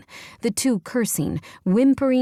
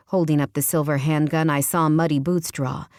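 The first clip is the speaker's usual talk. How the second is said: ordinary delivery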